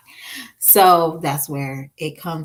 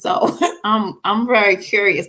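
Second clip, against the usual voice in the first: normal talking voice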